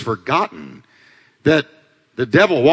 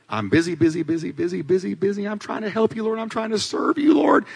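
softer voice climbing steadily higher, flustered; breathless-sounding mock fluster, building higher; frantic Martha; 5 semitones higher, 4 dB quieter than usual. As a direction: rattle it off busily, pitch rising to the end. Acts out frantic busy Martha.

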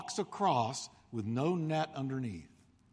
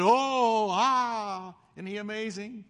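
amazed crowd; 4 semitones higher, 3 dB louder, slower than usual. Retold or imitated. Crowd watching tightrope walker marvels.

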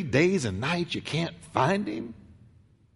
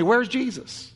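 ordinary talk

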